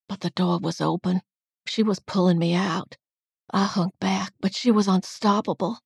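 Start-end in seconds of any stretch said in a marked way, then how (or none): none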